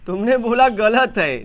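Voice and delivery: ordinary talk, nothing marked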